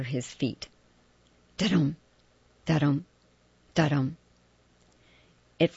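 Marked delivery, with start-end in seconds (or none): 1.6-4.2 heartbeat da-dum. A narrator's reading. Heartbeat sound effect chant.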